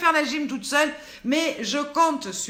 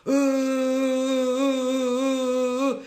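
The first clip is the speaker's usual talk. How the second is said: exaggerated vowel E; 3 semitones higher, 4 dB louder, slower than usual. Shown as a demonstration. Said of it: "il faut le faire avec les E".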